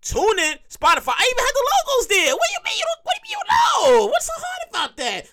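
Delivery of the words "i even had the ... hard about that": exasperated rant. Real, not acted. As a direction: voice shooting up high in disbelief. Ranting at confused listeners.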